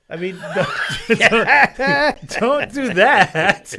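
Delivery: laughing through the words. Real, not acted. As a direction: laugh while scolding playfully. Laughing at viewer telling on herself.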